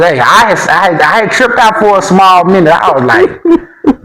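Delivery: normal talking voice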